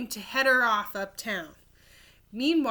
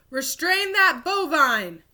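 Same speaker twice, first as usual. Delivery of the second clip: higher and louder alarmed shout; guard's cry; 6 semitones higher, 7 dB louder than usual. Acted. Guard yelling as the cow gallops past, loud shout. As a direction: bark the order across the hall. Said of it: "a guard cried".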